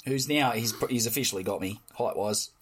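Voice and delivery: ordinary talk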